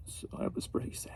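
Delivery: real hushed whisper over sad news. Real, not acted. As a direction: drop to a breathy whisper, moved. Sad story of burnt house.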